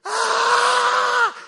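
loud high-pitched sustained scream; startled scream. Retold or imitated. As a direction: imitate a friend's long shriek of fright. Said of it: "ha hecho esta expresión". (grito agudo de susto)

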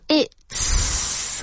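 "It's" as a voiceless hissing tss; voiceless teacher demo of "It's"; whispered it's. Shown as a demonstration. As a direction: breathe out "It's" with a sharp ts. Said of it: "the pronunciation of it's".

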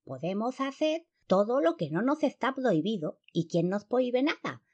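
high lisping clown moth; polilla payaso desafiante. A narrator's reading. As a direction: boast high, with a cartoon lisp. (polilla aguda y ceceante)

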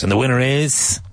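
lightly voiced awards host, building suspense; a hint of an awards-show announcer; Oscars announcer. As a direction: tease the winner like an Oscars host. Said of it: "like at the Oscars".

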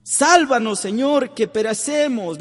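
high, loud cry for rescue; sinking apostles' cry. Retold or imitated. Apostles in the sinking boat shouting to Jesus, high loud cry. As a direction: call out as the boat goes down. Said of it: "le gritaron los apóstoles".

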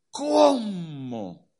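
a hammed-up wounded wail; mock tearful cry. Offended believer whimpers after hearing no. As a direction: whine it like a hurt believer.

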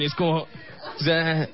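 ordinary delivery

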